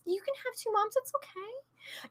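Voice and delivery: very high, tiny soft voice; playful high-pitched near-whisper; hushed reassurance. Pretend. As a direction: coo it softly, way up high. Playfully repeats reassurance as aside.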